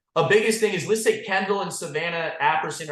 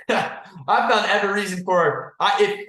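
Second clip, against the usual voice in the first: laughing through the words, slower; 4 semitones higher, 4 dB louder than usual. Real, not acted. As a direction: chuckle as you repeat it, amused at yourself. Laughs while repeating the line.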